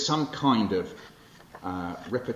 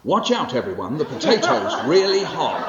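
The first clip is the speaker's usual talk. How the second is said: higher, louder mock shout of a dad; dad's shout; 5 semitones higher, 9 dB louder than usual. Dad warning the family about the hot potato, hammed-up shout. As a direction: call out the warning like a flustered father. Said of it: "he turns to us and he goes".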